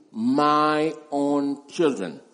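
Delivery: put-on "my" stressed hard and slow in "my own children"; emphatic my. Shown as a demonstration. Preacher showing the Greek emphasis, slow stress on "my". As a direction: press on "my", unhurried. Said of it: "emphatic position in the, in the Greek".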